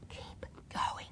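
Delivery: barely audible voiceless whisper; mouthed. A narrator's reading. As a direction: exaggerate the words with almost no breath. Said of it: "mouthed, with exaggerated lip movements".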